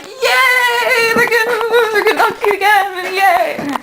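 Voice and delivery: very high, giggly squeal; fangirl cheer. Retold or imitated. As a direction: squeal with giddy delight like a thrilled viewer. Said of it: "And you're like".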